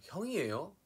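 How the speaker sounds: high, loud North Gyeongsang question; North Gyeongsang accent. Shown as a demonstration. Contrasting northern and southern regions, North Gyeongsang accent. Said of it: "경상북도 쪽은 약간".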